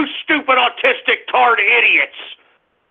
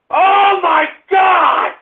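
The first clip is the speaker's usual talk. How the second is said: outraged; 6 semitones higher, much slower than usual. Acted. Outraged at racist prank.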